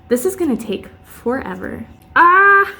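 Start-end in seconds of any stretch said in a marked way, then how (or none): 2.2-2.7 sharp loud yelp of pain; pained scream. Real, not acted. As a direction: yell out at the sudden sting. Pulls wax, sudden pain.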